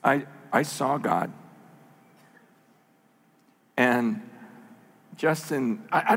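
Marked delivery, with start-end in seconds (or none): 0.0-1.3 low, offhand understatement; mildly imitated casual claim; casual remark. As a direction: toss it off casually, a little quietly. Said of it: "the guy kinda casually goes".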